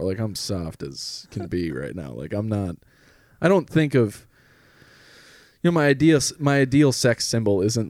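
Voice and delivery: ordinary talk, nothing marked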